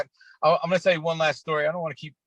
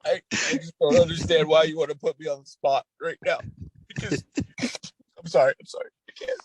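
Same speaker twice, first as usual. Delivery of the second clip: laughing. Real, not acted. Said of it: "doing a great job laughing".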